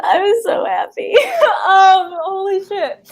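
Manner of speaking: laughing through happy tears, voice up high; overwhelmed winner half laughing, half crying; real joyful tears breaking through; tearful happy laughter. Real, not acted. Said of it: "I'm, I'm gonna cry".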